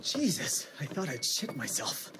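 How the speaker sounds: soft, low, shaken; shaken mutter. Acted. Virgil breathing out after the Secretary leaves. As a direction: let it out under your breath.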